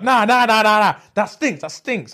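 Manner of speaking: rapid-fire high refusals; acted-out disgusted classmate; outraged protest. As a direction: object loudly, then repeat it. Classmate loudly protesting the smell.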